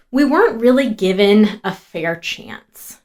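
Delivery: ordinary delivery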